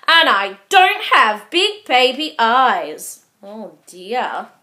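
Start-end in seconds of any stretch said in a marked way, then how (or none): 0.1-3.3 loud indignant shout. Acted. Baby Owl shouting at Squirrel, loud protest. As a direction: yell the protest. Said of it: "shouted Baby Owl".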